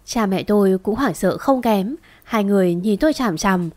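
ordinary delivery, nothing marked

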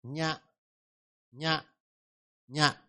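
nhạ said softly with a nasal ny start; nhạ drill. Shown as a demonstration. Teacher drills nhạ for the class. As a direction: repeat nhạ gently, three times. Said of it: "Thứ ba là".

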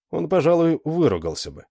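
ordinary delivery

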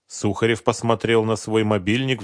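ordinary delivery, nothing marked

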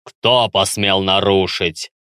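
low, slow echoing menace; ominous guttural voice. Acted. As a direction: rumble it from deep in the chest. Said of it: "эхом раздавался зловещий утробный голос".

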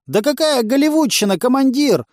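high, loud protest; indignant. A narrator's reading. Junkie correcting the commander about films, indignant. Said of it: "возмутился Клоп".

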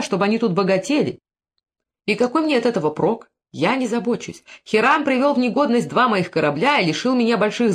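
aggrieved, moderately heated argument; getting heated. A narrator's reading. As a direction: protest with rising heat, pitch lifted. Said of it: "начиная опять горячиться".

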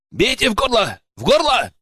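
high-pitched urgent battle cry; calling out. A narrator's reading. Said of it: "донёсся голос бояра". (выкрик приказа издалека)

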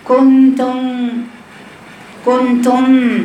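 كنتم drawn out very slowly with an added nun before the meem; كنتم stretched with a nasal nun sound before the meem; imitating wrong nasal. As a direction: mimic a student slipping a nun into كنتم. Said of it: "بتجيب الميم معاه تقول لك إيه؟".

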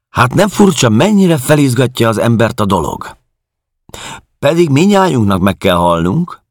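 very high, loud, agitated caretaker; worked-up caretaker. Acted. Narrator voices the worked-up caretaker. (felizgatott házmesternő, magas hangon)

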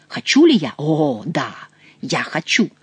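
seething desire, dropping into breath at the end; menacing vow. Acted. Count vows to kill his opponent.

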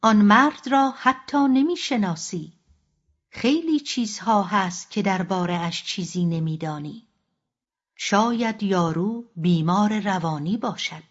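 plain reading voice

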